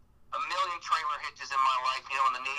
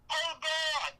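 put-on high, childish call over the walkie-talkie; imitating his son calling; 12 semitones higher than usual. Four-year-old son calling dad over walkie-talkie, high mock kid call. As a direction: pitch way up like a small kid yelling for dad. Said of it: "I hear".

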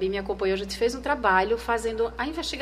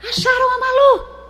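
much higher, much louder excited yell; retold shout; 13 dB louder than usual. Editor-in-chief yelling the news into her earpiece, loud excited shout. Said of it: "gritaram aqui no ouvido, meu, meu editor-chefe".

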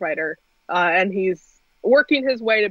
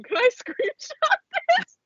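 much higher, breaking into a laugh mid-line. Retold or imitated. Laughing while quoting herself.

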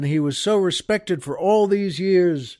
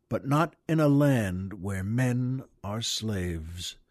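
slow, solemn poem reading; measured, grave reading of the poem; poem recital; 3 semitones lower than usual. Acted. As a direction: read it like a memorial verse. Reads a poem aloud.

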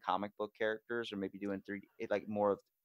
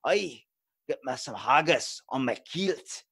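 loud, slow Scottish growl with grind; hammed-up Scot, higher and much louder; Scottish accent; 4 semitones higher, 8 dB louder, slower than usual. Shown as a demonstration. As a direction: bellow it in a rough Scottish burr. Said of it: "grind to your voice".

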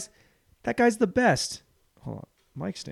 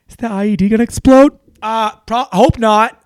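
louder, higher anxious excitement; mock tense viewer; 8 semitones higher, 10 dB louder than usual. A viewer caught up in The Hurt Locker, mock tense excitement. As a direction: play a viewer on the edge of their seat. Acting out the movie tension.

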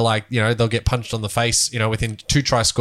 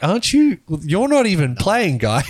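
higher-pitched imitation of disbelief; mock incredulous; 6 semitones higher than usual. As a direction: act out a baffled protest, pitched up. Acts out reaction to substitute fielder.

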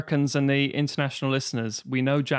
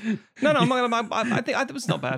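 high-pitched laughing defence; real amused self-defence; laughing protest; 9 semitones higher, 3 dB louder than usual. Real, not acted.

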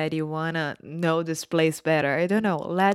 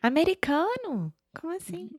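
surprised; 10 semitones higher than usual. Retold or imitated. Mock surprised Brazilian.

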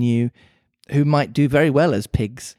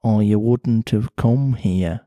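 put-on Mancunian accent; 3 semitones lower, slower than usual. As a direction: lower Mancunian cat voice. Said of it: "pretty Mancunian sounding accent".

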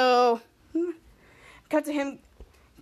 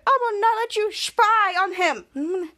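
firm refusal; 5 semitones higher, much slower than usual. Retold or imitated. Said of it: "Cut to Charlotte said".

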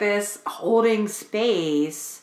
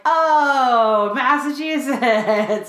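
higher, drawn-out gushing "Oh, Massachusetts"; mock amazement; 6 semitones higher, 6 dB louder, much slower than usual. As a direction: ham up the big discovery, slow and bright. Retelling own exaggerated reaction.

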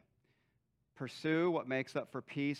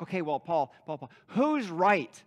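insistent questioning; 7 dB louder than usual. Acted. Congregant pressing Paul for answer.

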